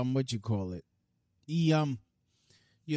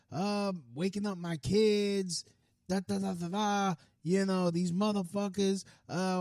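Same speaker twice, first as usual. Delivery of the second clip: higher mock rant of a neighbor's complaints; imitating angry neighbor; 8 semitones higher than usual. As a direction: ham up his cursing tirade. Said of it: "this is how he's talking".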